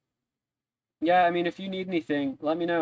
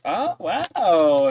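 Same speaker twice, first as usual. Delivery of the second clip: higher, louder put-on wow; politely impressed; 4 semitones higher, 7 dB louder than usual. Barkeep applauds the dwarf impression, loudly impressed. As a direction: draw out a show of admiration. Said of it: "claps a little bit".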